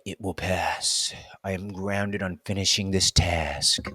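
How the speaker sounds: slow, soft, steady reassurance to herself; a mild hint of a calm inner voice; calm self-talk.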